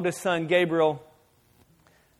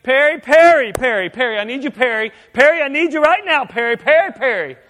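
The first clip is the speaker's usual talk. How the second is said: pestering husband; 2 semitones higher, 6 dB louder than usual. Retold or imitated. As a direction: nag her name again and again until it peaks in a yell. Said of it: "I wouldn't just be like".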